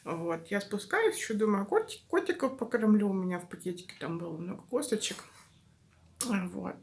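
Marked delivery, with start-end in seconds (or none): none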